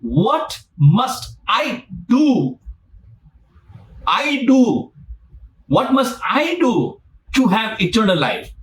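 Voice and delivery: high, slow, wide-eyed eager question; eager rich man. Retold or imitated. Rich man eagerly asking Jesus, high and drawn out. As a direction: stretch it out like a man desperate for the answer. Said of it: "one thing many people miss out".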